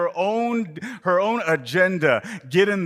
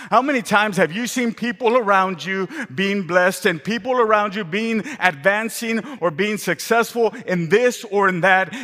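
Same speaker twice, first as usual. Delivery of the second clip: loud, higher preaching climax; full-force preaching; 7 semitones higher, 5 dB louder than usual. Acted. Preacher rousing congregation, full-force preaching. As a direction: build the sermon to a peak.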